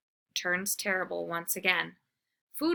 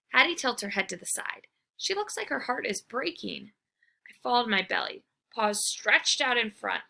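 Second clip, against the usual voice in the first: ordinary delivery, nothing marked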